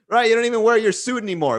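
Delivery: usual speaking voice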